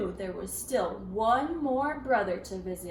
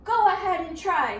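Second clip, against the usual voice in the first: defiant pig; 6 semitones higher, 7 dB louder, faster than usual. A narrator's reading. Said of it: "said Parker".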